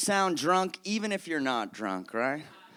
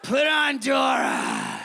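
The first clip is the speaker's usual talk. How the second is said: hammered little niece; 9 semitones higher than usual. Acted. Five-year-old niece demanding her cartoon, hammed-up drunken shout. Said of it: "She sounds hammered twenty-four hours a day".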